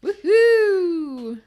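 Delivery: loud high whoop; celebratory woohoo to open the show; excited cheer. Real, not acted. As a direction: cheer it out, big and bright.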